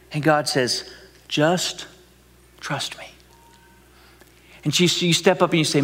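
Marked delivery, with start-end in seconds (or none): 1.3-3.0 soft whispered reassurance; quiet, tender trust me; gentle whisper. Pretend. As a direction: whisper it like God calming you. God answers gently.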